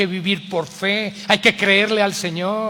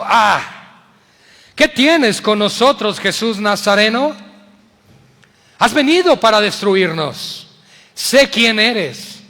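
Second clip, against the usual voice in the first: loud demon voice, higher and slow; demon crying out; 3 semitones higher, 8 dB louder, slower than usual. Acted. Unclean spirit shouting at Jesus in the synagogue, loud. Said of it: "dio voces diciendo".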